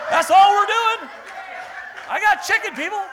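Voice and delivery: high, hammed-up yell of protest; exasperated shout. Offended preacher defending free chicken, exasperated shout. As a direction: shout it in mock desperation, pitch way up.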